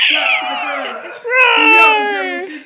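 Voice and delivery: high, loud, laughing narration; excited. A narrator's reading. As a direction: build it up wildly. Reader dramatizes the attack.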